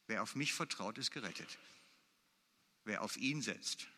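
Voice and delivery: a preacher softly echoing the promise he just read; soft, reflective repeat. Acted. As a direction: say it again quietly to yourself. Repeats the verse, softer.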